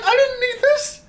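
high, loud, wounded protest; indignant squeal. Retold or imitated. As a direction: whine it in mock outrage. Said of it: "I was just like".

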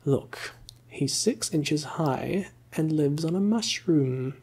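ordinary delivery

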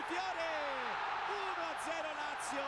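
loud, excited goal call; goal shout. Real, not acted. Goal call in live commentary.

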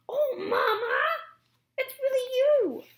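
high, loud, laughing through tears; joyful crying gosling. Acted. Gosling overjoyed to find his lost mother. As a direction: burst out with relief.

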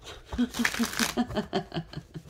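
high, soft run of chuckles; laughing. Real, not acted. Reader laughing after acting out the onlookers, amused chuckle. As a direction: chuckle at your own outburst.

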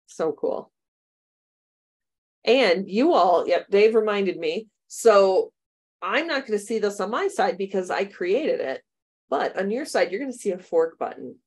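normal talking voice

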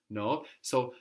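normal talking voice

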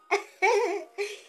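high, loud laugh; amused squealing laugh. Real, not acted. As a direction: burst out in high-pitched laughter. Amused by baby looking at her.

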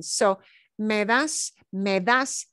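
"me das" spoken slowly in two clear syllables. Shown as a demonstration. Teacher to students, slow, syllable by syllable. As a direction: sound out "me das" as two beats. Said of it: "me das is two syllables".

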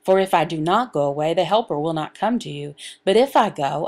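ordinary delivery

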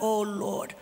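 hushed, low "oh, Lord"; convicted believer murmuring to God; sheepish realization. Retold or imitated. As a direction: admit you're caught, softly. Caught out by the question.

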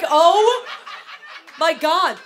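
high, very loud comic outburst; exasperated yell. Retold or imitated. Daughter reacts to mom's pretty-color excuse, yelling in exasperation. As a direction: shriek it in disbelief, stretching the words.

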